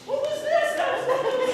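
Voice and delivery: high, laughing fake astonishment; mock disbelief. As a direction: tease them with exaggerated shock. Mascot mocking the quiet promise.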